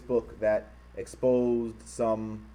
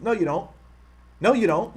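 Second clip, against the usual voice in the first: louder, higher repeated denial; emphatic rebuttal; 6 semitones higher, 5 dB louder than usual. Real, not acted. Streamer calling out the bigots' lie, loud retort. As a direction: hammer the no.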